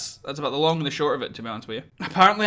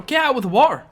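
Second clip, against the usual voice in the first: exasperated call; 7 semitones higher, 5 dB louder than usual. Real, not acted. Yelling at the game.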